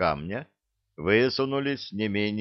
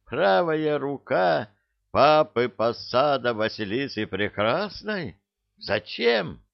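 astonished; 6 semitones higher than usual. A narrator's reading. Astonished questioning.

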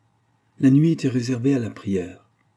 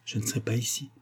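much softer, hushed ending; low voice; 8 dB quieter, faster than usual. A narrator's reading. Fiancé murmurs to the sick girl. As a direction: drop almost to a murmur. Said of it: "lui dit-il à voix basse".

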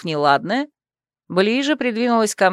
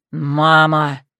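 groaned; 5 semitones lower than usual. A narrator's reading. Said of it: "простонал Андре".

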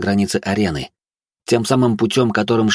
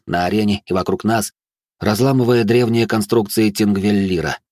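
ordinary delivery, nothing marked

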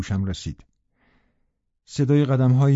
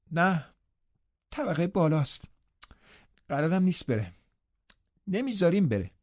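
put-on higher, softer petulant boy; a spoiled invalid boy refusing; sullen sickly boy; 6 semitones higher, 4 dB quieter than usual. A narrator's reading. Linton sulkily refusing the housekeeper, soft and higher.

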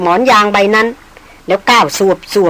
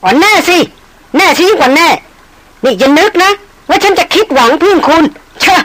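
scornful fury; 8 semitones higher than usual. Acted. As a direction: snarl like a leopardess. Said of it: "หล่อนกลายเป็นนางเสือดาวไปละ". (ตอบกลับอย่างดูแคลนโกรธจัด)

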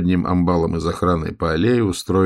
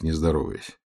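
plain reading voice

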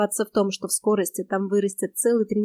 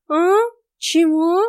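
high, startled wake-up yelp; startled cat; 8 semitones higher, 7 dB louder, much slower than usual. Acted. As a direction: jolt awake like a startled cat. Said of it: "встрепенулся Васька". (испуганный кот спросонья)

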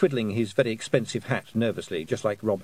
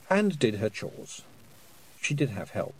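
ordinary delivery, nothing marked

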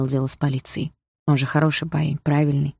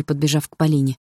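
ordinary delivery, nothing marked

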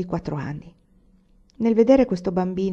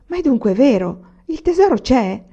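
higher and louder, eager and anxious; 5 semitones higher, 6 dB louder than usual. A narrator's reading. Olì asking Anania about the treasure, anxiously eager. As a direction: ask breathlessly, hoping it is true. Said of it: "domandò ansiosa".

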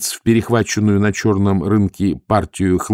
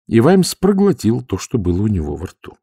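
ordinary delivery

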